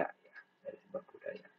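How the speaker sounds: soft breathy repeat under the breath; whispered echo. Shown as a demonstration. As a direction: let the phrase fall away into a whisper. Lecturer repeats phrase as an echo.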